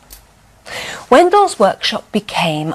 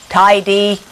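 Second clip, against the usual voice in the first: robot voice; 6 dB louder than usual. A narrator's reading. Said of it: "the Wendell Bot said".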